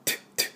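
"トゥ" as a short burst of t; crisp isolated "トゥ" puff; spitting T sound. Shown as a demonstration. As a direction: spit out the t of "トゥ" without the spit. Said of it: "唾を飛ばさず、".

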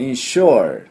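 "インシュア" as English insure, in-SHURE; loud teacher's demo of "インシュア"; English pronunciation. Shown as a demonstration. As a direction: model "インシュア" with a long r-coloured ending. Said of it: "このとこです".

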